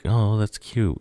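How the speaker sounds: high-pitched, lightly voiced girlish "aww"; fond cooing. Voices girl finding crush sweet.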